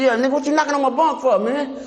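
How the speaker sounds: high-pitched indignant challenge; irritated cellmate confronting bunkmate; retold angry retort. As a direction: snap back at the guy who woke you. Woken by cellmate knocking, confronts him.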